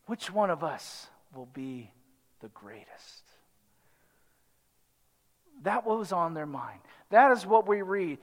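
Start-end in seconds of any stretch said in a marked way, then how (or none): 0.0-3.2 dropping to a near hush on the greatest; hushed, much softer voicing of the disciples; hushed question. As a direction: let the disciples' question sink low and quiet. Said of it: "what was that question?".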